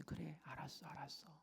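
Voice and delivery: hushed, indulgent agreement; quiet assent. Acted. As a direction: give in gently, almost under your breath. God giving in to Abraham's plea.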